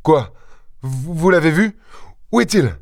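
high, faltering pleading questions. A narrator's reading. Mère Goussot pressing the stranger, pleading. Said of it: "la mère Goussot supplia".

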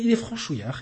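ordinary delivery

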